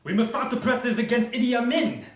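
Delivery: rally them in a panic; urgent shouting. Acted. Journalists urgent alarm.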